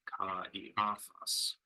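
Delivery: slow "ka, e, a, thos", each vowel its own syllable; vowels pronounced separately. Shown as a demonstration. Teacher correcting the English form, slow separated "ka, e, a, thos". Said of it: "need to be pronounced separately".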